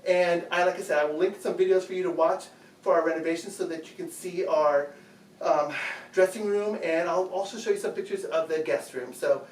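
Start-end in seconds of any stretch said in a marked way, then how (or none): none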